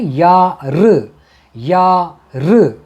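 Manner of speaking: यार drawn out very slowly, each one held long; teacher modelling यार slowly; slow Tamil "yaar". Shown as a demonstration. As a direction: stretch out यार slowly for learners. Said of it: "कैसे बोलते हैं?".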